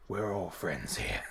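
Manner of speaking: low, soft, slow mock reassurance; low playful voice. Host reassuring viewers in the stream chat, playful low voice. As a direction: drop it way down and draw it out.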